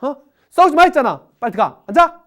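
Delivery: high, loud scolding bursts; mom yelling. Retold or imitated. Mother scolding her kids to tidy up and sit, shouting high and loud. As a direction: bark orders at the kids to clean up and sit. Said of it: "애들한테 막 소리 지르잖아".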